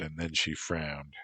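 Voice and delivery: plain reading voice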